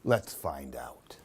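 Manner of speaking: secretive whisper. Real, not acted. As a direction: lean in and whisper it. Aside to child before ending.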